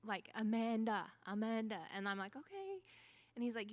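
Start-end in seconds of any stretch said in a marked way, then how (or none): 0.3-1.8 mild mock yell, calling a name; retold yell. 2.3-2.8 high, softer sing-out answer; high-pitched reply. Retold or imitated. Reluctant answer to being called.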